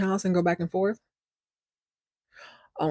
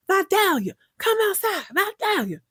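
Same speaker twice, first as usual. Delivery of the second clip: loud calling out; 11 semitones higher than usual. Retold or imitated. Husband outside the ranch house, yelling for his wife. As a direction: yell "Vidalia" much higher, calling up at the house. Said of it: "He's loud".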